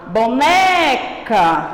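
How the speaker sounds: boneca with NÉ drawn out long; stress on NÉ. Shown as a demonstration. Teacher showing pupils the tonic syllable, stressed NÉ in boneca. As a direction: stretch the middle syllable of boneca, bo-NÉÉ-ca. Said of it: "quando a tia entoa, o né".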